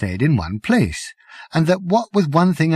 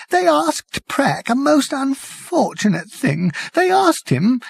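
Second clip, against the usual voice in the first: much higher, slow, tearful voice; weeping whisper; 12 semitones higher, slower than usual. Acted. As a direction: whimper it out through tears. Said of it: "he said in a weeping whisper".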